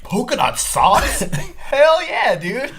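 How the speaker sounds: high, slow, thrilled and laughing; excited. Retold or imitated. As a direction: squeal it out, delighted. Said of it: "Jordan took it as".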